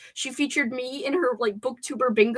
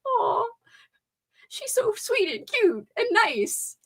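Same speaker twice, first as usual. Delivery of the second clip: high, slow, sugary aw; cooing gush; 10 semitones higher, slower than usual. Retold or imitated. Gushing about her friend Leandra in a mock cooing voice. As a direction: coo over her like a darling. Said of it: "In a, like".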